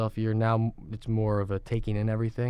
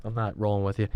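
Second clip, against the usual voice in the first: usual speaking voice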